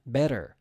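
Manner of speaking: "better" with a pronounced final r; American "better", tapped t and r-coloured ending. Shown as a demonstration. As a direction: sound the r at the end of "better". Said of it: "the R is pronounced in the United States".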